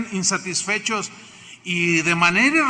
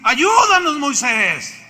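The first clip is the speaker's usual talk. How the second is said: high, loud cry for help; desperate shout to Moisés for help; pleading cry; 7 semitones higher, 7 dB louder, much slower than usual. Retold or imitated. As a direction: beg Moisés loudly for rescue. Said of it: "Y le dicen".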